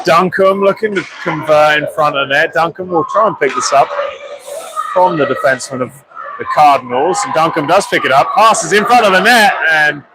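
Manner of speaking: steady live hockey commentary; play-by-play. Acted. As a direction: call the game as it unfolds.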